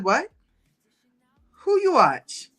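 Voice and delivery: high, disbelieving what; mimicking a surprised mom; incredulous mock question. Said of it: "She said".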